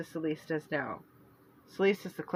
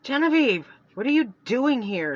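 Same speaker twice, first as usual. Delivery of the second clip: sharp, higher and louder; irritated sister caught off guard, much higher and louder; 6 semitones higher, 6 dB louder than usual. Acted. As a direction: Celeste rounding on her sister, irritated and loud. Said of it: "Celeste was irritated".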